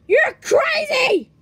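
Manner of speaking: slow, high, loud accusation; hammed-up outraged shout; angry shout. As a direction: yell it, drawing it out. Puppet quarrel.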